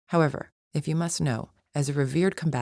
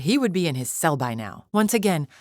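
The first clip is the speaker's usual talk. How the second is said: ordinary talk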